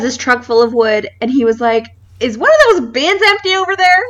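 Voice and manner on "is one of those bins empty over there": high, mock Kansas City accent; thick Kansas City accent. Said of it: "the thickest accent".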